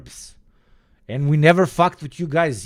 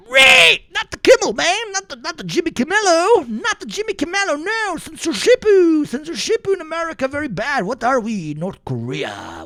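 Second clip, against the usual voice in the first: mock panicked normie; 9 semitones higher than usual. Streamer imitating normies over Kimmel censorship, high frantic mock outrage. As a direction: shriek like a clueless normie losing it. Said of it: "normies immediately go".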